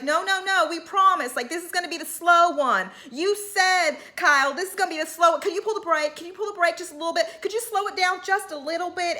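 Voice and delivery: high, pleading mock voice of a nervous rider; pleading Sutton. Host mimics Sutton begging Kyle to brake, high and pleading. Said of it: "Sun's like".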